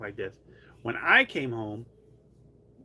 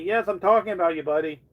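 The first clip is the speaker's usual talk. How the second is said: higher, louder sing-song to the cat; pet talk; 4 semitones higher, 7 dB louder than usual. Real, not acted. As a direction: coax him with fondness. Answering the cat fondly.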